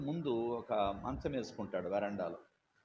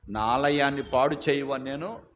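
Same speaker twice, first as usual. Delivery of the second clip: forceful quote; 9 dB louder than usual. Retold or imitated. Quoting scripture in the argument.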